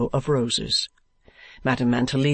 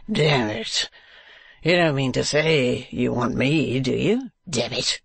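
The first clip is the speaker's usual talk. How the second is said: Mantalini's voice. A narrator's reading. The foppish gentleman speaks.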